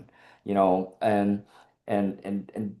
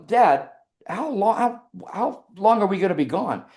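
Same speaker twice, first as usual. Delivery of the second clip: retold incredulity; 8 semitones higher than usual. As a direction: question Dad in stunned disbelief, as retold. Incredulous son questioning dad, retold.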